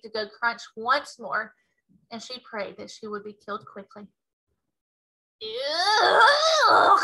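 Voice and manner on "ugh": high, loud roar of disgust; a giant gagging roar; roaring ugh of disgust. Acted. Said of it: "roared the Bloodbottler".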